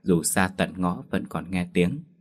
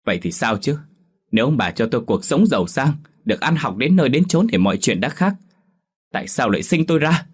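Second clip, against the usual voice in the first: higher and louder, hurling blame; defiant yelling; 5 semitones higher, 4 dB louder, faster than usual. Acted. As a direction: shout back resentfully at the parents. Daughter yells back at parents. (con gái gào lên oán trách cha mẹ)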